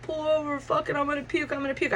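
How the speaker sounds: high, hushed queasy urgency; queasy urgent. Retold or imitated. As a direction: plead to pull over, about to retch. Donnie gagging about to puke.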